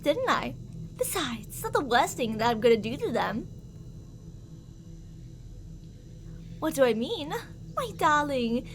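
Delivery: a possessive captor's sweet, menacing voice; obsessive lover. Acted. Captor sweet-talks the darling they kidnapped, creepy and possessive. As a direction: croon to your darling while threatening the captive.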